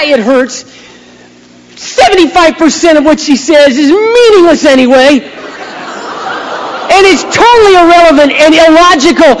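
high, loud, strained comic outburst; exasperated rant. Acted. Pastor advising husbands to just take it, exasperated rant. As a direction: push it high and loud, mock-anguished.

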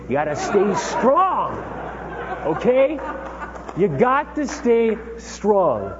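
slow, drawn-out, rising insistence; emphatic urging. Real, not acted. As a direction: lean hard on every word.

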